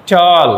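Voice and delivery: "টল" loud and held as one beat; one-syllable demonstration. Shown as a demonstration. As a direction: punch out "টল" as a single long syllable. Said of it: "এক syllable বিশিষ্ট".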